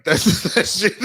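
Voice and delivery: ordinary talk, nothing marked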